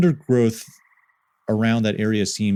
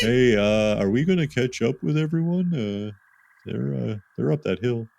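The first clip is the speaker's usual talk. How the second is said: slack jawed dopey voice; 2 semitones higher, 4 dB quieter than usual. Acted. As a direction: play Rowdy a little dim and soft. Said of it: "I'm giving Rowdy a dopey voice".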